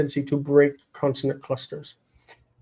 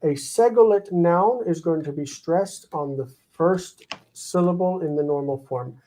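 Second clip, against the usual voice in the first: usual speaking voice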